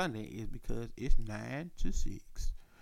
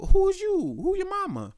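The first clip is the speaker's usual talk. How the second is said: much higher and louder, who is this; high demanding question; 4 dB louder than usual. Retold or imitated. Calling the unknown number, high demanding voice. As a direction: pitch up and demand to know.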